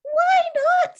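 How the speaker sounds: high, loud "why not"; eager Winnie. Acted. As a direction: toss it off way up and bold. Winnie voiced in read story.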